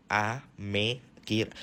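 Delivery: "Amekir-" very slowly, broken off unfinished; stumbling mispronunciation. Acted. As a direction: sound out "Amekir-" syllable by syllable and get stuck. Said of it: "susah banget nyebut nama negara".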